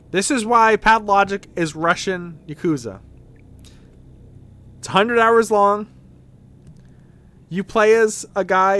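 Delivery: loud, punchy case-making; emphatic arguing. Real, not acted. Streamer arguing to viewers that Pathologic is Russian Yakuza, loud and emphatic. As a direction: hammer each point home loudly. Mock-earnest argument to viewers.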